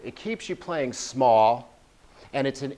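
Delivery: usual speaking voice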